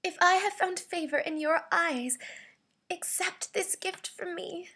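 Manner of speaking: high, beseeching plea; pleading, weakly. Shown as a demonstration. Voice actor begging someone to accept a gift, pleading weakly. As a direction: beg them in a high, weak voice. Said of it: "This is my pleading slash weekly read".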